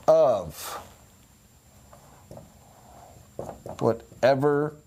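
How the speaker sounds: each word set apart by long pauses; slow, word-by-word delivery of a rule; slow dictation. Shown as a demonstration. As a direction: spell it out one word at a time, like dictating to a class. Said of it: "dictates the rule word by word".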